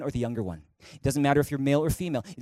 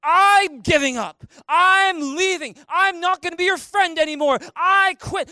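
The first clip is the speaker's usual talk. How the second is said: high, loud hammed-up outburst; mock relationship-ending shouts; acted threats; 11 semitones higher, 7 dB louder than usual. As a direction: yell dramatic ultimatums, stretching the opening words. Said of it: "Those are threats".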